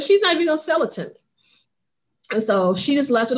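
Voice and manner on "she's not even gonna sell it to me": high, rushed disbelief; mock exasperated objection; retold protest. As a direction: blurt it out fast as a doubtful aside. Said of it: "and I said".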